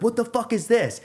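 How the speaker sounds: confronting the thief, voice jumps up; pulled his own wallet from the drunk's pocket, high indignant; retold outrage. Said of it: "I'm like".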